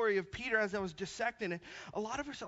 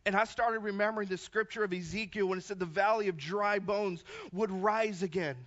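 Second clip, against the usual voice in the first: ordinary delivery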